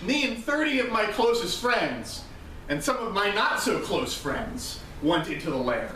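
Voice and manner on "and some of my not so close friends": normal talking voice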